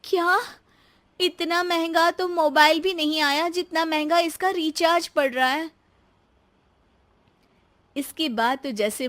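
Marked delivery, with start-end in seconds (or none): 0.0-5.7 high-pitched, dismayed disbelief; shocked daughter-in-law. Acted. Daughter-in-law learning of costly phone calls, shocked. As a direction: balk at the cost, pitch up.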